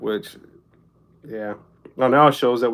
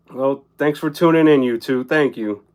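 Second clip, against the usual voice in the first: ordinary delivery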